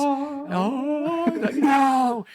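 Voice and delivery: high, loud hammed-up "No!"; mock dramatic scream. Pitching a movie scene, a hammed-up dramatic scream. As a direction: belt it like a movie villain's victim.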